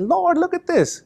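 high, excited retelling of amazed disciples; voicing astonished followers. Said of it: "disciples got all excited and said".

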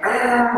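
imitated demon "arr"; short mock snarl; demon growl. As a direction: mimic a possessed creature's outburst. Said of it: "And it went".

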